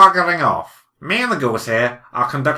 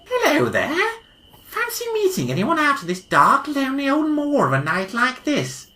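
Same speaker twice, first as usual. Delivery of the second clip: a lightly voiced, higher and friendly passer-by; cheery cyclist; 10 semitones higher than usual. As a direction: greet them like a jolly stranger on the moor. S0 plays the cyclist Eric.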